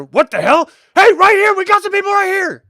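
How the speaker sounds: high, loud call to the others; alarmed shout. Acted. Gang member spotting the party and calling his friends, high and loud. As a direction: yell for backup on spotting them. Guard spots intruders, calls out.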